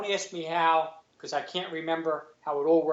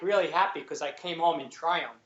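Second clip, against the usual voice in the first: ordinary delivery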